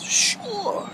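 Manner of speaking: sure sung out very high and bright; chirpy sure. Retold or imitated. Waitress chirping a high bright sure to customer. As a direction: chime it like an eager waitress.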